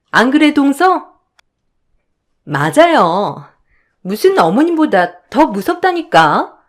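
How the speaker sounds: whiny, needling sister-in-law voice; snide sister-in-law. Acted. Younger sisters-in-law gang up on the eldest, snide complaining. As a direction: protest with mock innocence.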